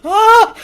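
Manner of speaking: high, loud re-enacted gasp. Viewer re-enacting a reaction to the scene, loud gasp.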